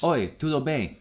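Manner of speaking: Brazilian Portuguese phrase; Portuguese greeting. Retold or imitated. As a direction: say it like a learner. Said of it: "I learned to say".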